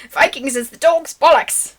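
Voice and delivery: cross country American with Cockney, badly; terrible Cockney accent. Trying British slang on a cohost, badly faked Cockney. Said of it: "I can't do the accent. I'm terrible".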